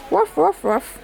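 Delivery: high playful woofs, dropping at the end; dog bark imitation. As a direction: bark like a little dog for a baby. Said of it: "Press the dog".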